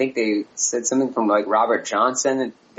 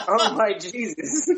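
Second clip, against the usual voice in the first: high, slow words through laughter; laughing lyric quote; 6 semitones higher than usual. Retold or imitated. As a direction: giggle out the real lyric. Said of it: "Turns out he was saying".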